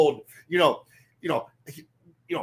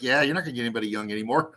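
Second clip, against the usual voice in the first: lower mock voice; 8 semitones lower, much faster than usual. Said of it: "They're like".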